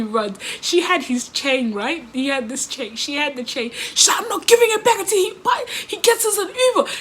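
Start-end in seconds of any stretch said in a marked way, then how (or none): 4.2-6.9 put-on higher, fast, defiant girl refusing; angry demand. Friend holding the chain refuses to give it back, fast and high. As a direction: snap it back like a stubborn friend. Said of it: "she was bare angry".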